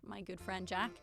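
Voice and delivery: ordinary talk, nothing marked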